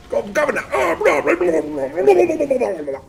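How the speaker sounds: high, loud hammed-up British death babble; dying British person. Streamer mimicking how British people die, high garbled wail. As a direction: babble out a British death cry, falling at the end. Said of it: "That's how every British person sounds when they die".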